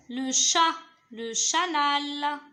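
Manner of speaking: "chanal" slow and firm, both syllables held; joining syllables. Shown as a demonstration. Teacher testing the second syllable, "chanal" slowly sounded out. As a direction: sound out "chat", then stretch it into "chanal". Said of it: "Je relis les syllabes".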